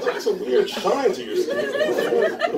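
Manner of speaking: high, slow words through laughter; giggly mock politeness; laughing remark. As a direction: laugh it out while teasing. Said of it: "I was like".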